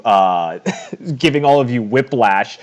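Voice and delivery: normal talking voice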